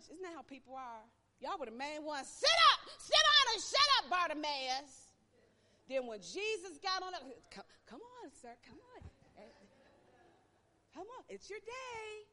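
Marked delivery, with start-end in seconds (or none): 2.3-5.1 loud, high, harsh scolding; angry scolding. Retold or imitated. Crowd scolding the blind beggar, loud and angry. 7.5-12.3 soft, high coaxing; sweet coaxing. Retold or imitated. Crowd suddenly sweet to the beggar, soft and coaxing. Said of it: "That whole tune changed".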